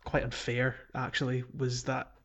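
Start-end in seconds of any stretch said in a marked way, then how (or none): none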